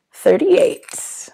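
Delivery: high, breathy whispered number; whispered reveal. Real, not acted. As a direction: whisper it like a secret.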